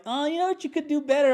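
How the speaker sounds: high, loud mock nitpicking guest; snooty guest. A visitor critiques his home, mocked in a high, loud voice. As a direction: mimic a guest picking faults in the house.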